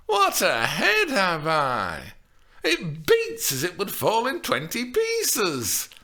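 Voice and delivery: high, loud hammed-up lament; milk the headache for sympathy; complaining nurse. A narrator's reading. Old nurse hams her aches to Juliet.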